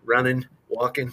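"running, walking" said as runnin', walkin'; dropped g. Shown as a demonstration. Host showing how Bostonians drop their g's. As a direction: clip the ing to in. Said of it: "any I N G is just in".

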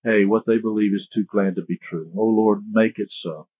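low-key, subdued pastoral prayer. Real, not acted. Pastor asking the Lord to help the congregation, subdued prayer. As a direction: plead humbly with God, letting phrases drop soft and low.

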